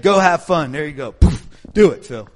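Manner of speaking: loud, offhand send-off; breezy boss. Retold or imitated. Retelling bosses' loud casual send-off. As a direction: toss the job over casually. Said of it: "and said".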